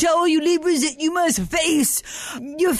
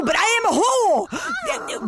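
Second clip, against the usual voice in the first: higher, loud blurted admission; blurted confession; 9 semitones higher, slower than usual. Acted. Drunk astrologer blurts a confession on radio, loud and high. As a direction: blurt it out too loudly. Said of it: "Did I say that so loudly?".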